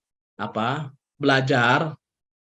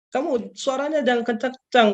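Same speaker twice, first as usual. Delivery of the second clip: lightly imitated mother; 10 semitones higher than usual. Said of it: "lembut suaranya dan ngomongnya nggak".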